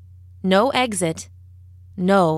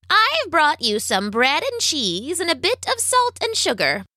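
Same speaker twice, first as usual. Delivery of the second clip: high, swooping witch voice; old witch; 8 semitones higher than usual. A narrator's reading. Witch brings food to the captive, high swooping witch voice. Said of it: "said the witch".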